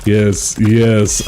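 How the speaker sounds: ordinary talk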